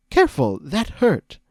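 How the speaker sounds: high, loud and hurt; pained. Acted. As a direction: complain in shocked pain. Discord just got hit.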